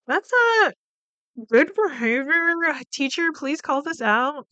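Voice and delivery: high-pitched mock pleading; exasperated plea. Viewer begging the teacher to call out Laura, high exasperated plea. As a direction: strain up high, begging someone to step in.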